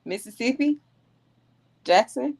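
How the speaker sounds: retold tentative answer. Answering locals who asked where she's from, in a tentative rising voice. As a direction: answer in a high, questioning lilt. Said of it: "I'm like".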